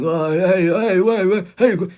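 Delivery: put-on slurred drunk calling out, high and loud; hammed-up boozy hollering; Frank from Shameless. Imitating the drunk on British Shameless, loud slurring. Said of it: "they sound like that guy on Shameless".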